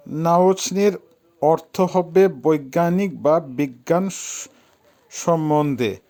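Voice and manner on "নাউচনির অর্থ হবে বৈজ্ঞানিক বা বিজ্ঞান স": ordinary delivery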